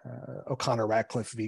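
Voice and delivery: usual speaking voice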